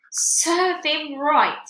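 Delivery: very slow, high, loud smug mother; wife gloating over the boy, slow and loud. Acted. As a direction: drag it out with self-satisfied relish. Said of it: "Missus Wormwood said".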